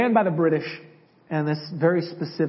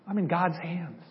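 ordinary talk

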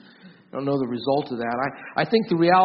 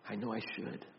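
softer, lower, fading to almost nothing; fading retold refusal; 7 semitones lower, 14 dB quieter than usual. Pastor retelling the addict's refusal, fading into a near whisper. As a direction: let the man's refusal die away on each repeat. Repeating the man's answer, fading.

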